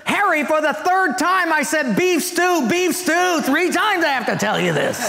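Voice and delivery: high-pitched, hammed-up exasperation; exasperated wife. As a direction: play a wife fed up with repeating herself. Wife exasperated at repeating herself.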